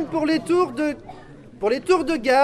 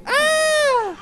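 laughing shout; 12 semitones higher than usual. Real, not acted. Laughing cry at the mix-up.

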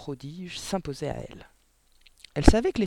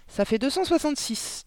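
put-on high, gentle child answer, ça fait deux cent soixante-six said softly; a girl says the number softly and calmly; soft little girl voice; 11 semitones higher, 4 dB louder than usual. Girl answers her teacher in a soft voice. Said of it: "dit Mathilda d'une voix douce".

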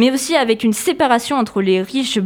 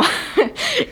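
laugh. Real, not acted. Classmate answers the quiz.

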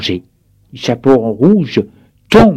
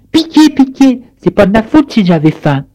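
much higher, pleading; wolf crying for mercy; 9 semitones higher, 4 dB louder than usual. A narrator's reading. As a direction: beg for mercy, pitiful. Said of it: "et crie". (le loup supplie en criant)

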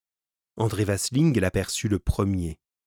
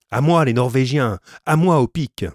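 higher, louder shout for his men; calling for help; 4 semitones higher, 7 dB louder than usual. A narrator's reading. Vasling calling his Norwegians to arms, shouting. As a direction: yell to rally the Norwegians. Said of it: "en s'écriant".

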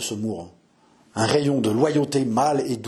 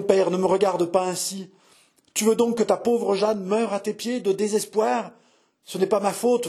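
higher-pitched, imploring confession; anguished pleading; 6 semitones higher than usual. Acted. Daughter on her knees confessing her love to her father, anguished pleading. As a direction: beg a father's forgiveness.

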